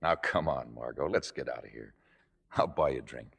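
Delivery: low, soft peace offering; gentle coaxing. Acted. Boyfriend offering a drink after the row, gently coaxing. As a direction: drop the voice and coax softly.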